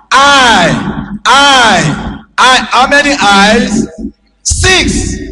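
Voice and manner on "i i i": each I hit hard, spaced apart; stress on I. Shown as a demonstration. Preacher hammering the word I at the congregation. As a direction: punch every I. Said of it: "emphasis is on the I".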